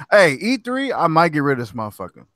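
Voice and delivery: ordinary delivery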